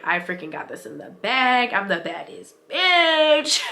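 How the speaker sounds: building to a high, loud, drawn-out brag; cocky self-hype. Acted. Mom hyping herself up on her good days, swaggering. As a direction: stretch the ending into a triumphant mock yell. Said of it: "other days I'm like".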